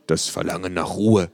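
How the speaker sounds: ordinary delivery, nothing marked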